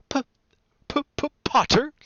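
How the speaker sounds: high, stuttering timid professor; nervous stammer. A narrator's reading. Professor Quirrell greeting Harry, high nervous stammer. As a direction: trip repeatedly on the first sound. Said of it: "stammered Professor Quirrell".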